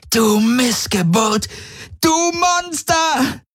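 high screech, each word stretched out; screeched insults. Acted. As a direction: hurl the insults in a slow, furious shriek. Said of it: "kreischte sie". (wütend gekreischte Beschimpfung)

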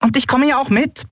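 tiny, very high-pitched voice; piping squeak. A narrator's reading. Nelen insists on coming along, piping high. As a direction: pipe up in a high little squeak. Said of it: "piepste Nelen".

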